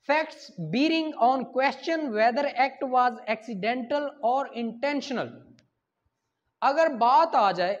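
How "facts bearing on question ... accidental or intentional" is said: slow reading. Shown as a demonstration. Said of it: "reading the article title slowly".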